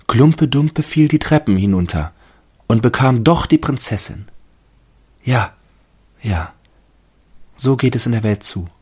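hohe, lautere, gläubige Baumstimme; Stimme des Baumes. A narrator's reading. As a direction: als staunender Tannenbaum. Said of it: "dachte der Tannenbaum". (hohe, überzeugte Baumstimme)

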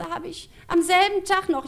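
put-on high-pitched, slow old-lady answer; grandma voice. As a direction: draw out granny's punchline. Grandma answers about hidden key.

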